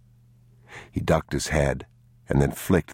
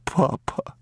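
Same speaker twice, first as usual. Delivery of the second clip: almost in tears; 11 semitones higher than usual. A narrator's reading. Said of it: "He was almost in tears".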